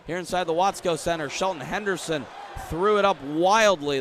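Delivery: ordinary talk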